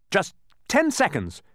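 put-on high, excited boy; excited cry. A narrator's reading. Milo times the bee, high and excited. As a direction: cry out the time limit excitedly. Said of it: "cried Milo excitedly".

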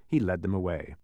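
usual speaking voice

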